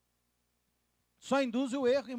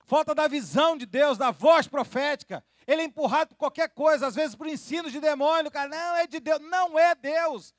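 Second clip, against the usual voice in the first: loud, high, fiery preacher's cry; thundering pulpit delivery; full-force preaching; 8 semitones higher, 8 dB louder than usual. Acted. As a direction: preach it hard to the congregation.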